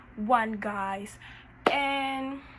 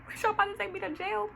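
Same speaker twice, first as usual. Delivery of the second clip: high, rising panicked voice; hammed-up scared pleading; mock panic; 8 semitones higher, 8 dB louder than usual. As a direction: freak out in a high squeal. Panicking about jail.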